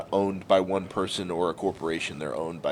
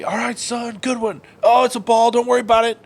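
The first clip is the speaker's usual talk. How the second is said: high-pitched, hollered sideline cheer; encouraging dad; 11 semitones higher, 4 dB louder than usual. Retold or imitated. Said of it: "Always encouraged me".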